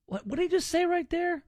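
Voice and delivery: can't believe what he just heard; incredulous. Real, not acted.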